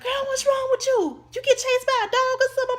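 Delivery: very high-pitched, fussing grandmother; imitated grandmother. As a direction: put on a high voice like a startled granny. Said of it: "my grandmother was like".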